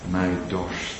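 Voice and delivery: soft, low hushed words; quiet echo. Acted. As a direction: let it sink in softly. (zacht en laag uitgesproken)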